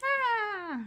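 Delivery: high, loud mock dinosaur screech; pterodactyl shriek. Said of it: "pterodactyl sounds".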